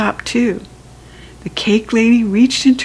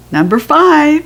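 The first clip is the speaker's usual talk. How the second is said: louder, higher number call; 7 semitones higher, 5 dB louder than usual. A narrator's reading. Cake lady calling the number loudly to children. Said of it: "she said very loud".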